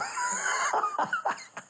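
a high burst of laughter. Real, not acted. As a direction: laugh at the memory.

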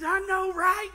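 loud, high-pitched excited agreement; fired up. Retold or imitated. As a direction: burst out as excited as your buddy. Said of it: "I'm as fired up with him".

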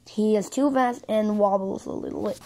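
usual speaking voice

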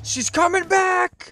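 high, loud alarmed yell; alarmed shout. Real, not acted. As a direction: shout the warning. Dragon returning, alarm.

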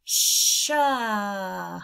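"sha" as in shut; blended sound. Shown as a demonstration. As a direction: glide "sh" into "uh". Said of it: "it says".

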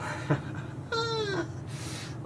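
soft, very high squeal of laughter. Real, not acted. Storyteller amused by own story, squealing laugh. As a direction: let out a small, squeaky laugh.

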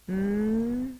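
"M-A-T-O" as long separate sounds: m, ay, t, oh; sounded out. Shown as a demonstration. Teacher finishing tomato, "M-A-T-O" one drawn-out sound at a time. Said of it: "Sound it out".

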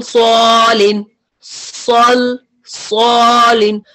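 सॉलिन drawn out slowly and thick, सल heavy; heavy word demo. Shown as a demonstration. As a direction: stretch सॉलिन slowly, full-mouthed. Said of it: "जैसे आप पढ़ते हैं सल".

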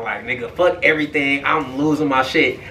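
ordinary talk

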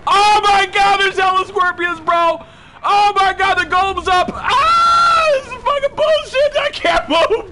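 high, loud panicked screams; screaming for mom and dad while stuck; terrified screaming. Real, not acted. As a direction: scream for help in real fright. Trapped by golem and scorpions, panicking.

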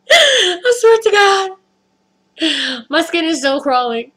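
high, tearful, drawn-out whine; whiny diva. Real, not acted. Freaked-out storyteller with skin crawling, diva whine. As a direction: wail it like a distressed diva. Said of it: "I sound like a diva".

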